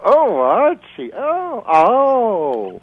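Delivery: high, slow, whiny housewife wail; Edith Bunker impression. Mimicking a TV wife calling to her husband, high and slow. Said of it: "she'd go".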